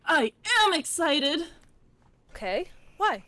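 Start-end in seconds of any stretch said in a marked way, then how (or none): 0.0-1.4 loud excited exclaim. Acted. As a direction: burst out excited. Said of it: "You seem more excited than usual".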